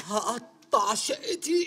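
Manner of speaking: slow mock obedience; very slow repeat. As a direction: stretch it like a dazed echo. Guest repeats host's order, mangled.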